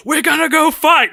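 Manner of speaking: high, loud rallying yell; hammed-up war cry; mock battle cry. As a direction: ham up a king rousing troops. Mocking Theoden rallying his army.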